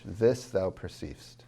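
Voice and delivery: THIS thou perceivest, slow, thou lighter; stress on THIS. Shown as a demonstration. Teacher shows natural emphasis in couplet, stress on THIS. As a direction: lean on This, ease off thou. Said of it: "This is emphasized more than thou".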